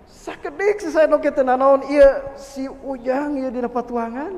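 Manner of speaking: slow, loud begging; pleading wife. Acted. Pregnant wife to husband, slow loud begging. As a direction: beg for the baby's sake.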